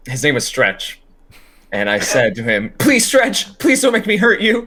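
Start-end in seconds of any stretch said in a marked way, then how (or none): 2.8-4.7 high, loud theatrical plea; begging. Acted. Last line of the show, to Stretch on his knees, begging. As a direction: plead with him at full pitch, hammed up. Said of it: "And I begged to this character".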